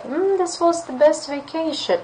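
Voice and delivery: bright high interested reply; showing interest. Shown as a demonstration. Teacher demoing an interested reply to a student. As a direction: react with eager interest. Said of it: "you show interest, you react".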